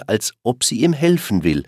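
plain reading voice

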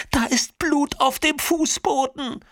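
hoch, langsam und gellend; panischer Schrei. A narrator's reading. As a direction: schrei entsetzt das Blut heraus. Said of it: "schreit Nina". (hoher, gedehnter Schreckensschrei)

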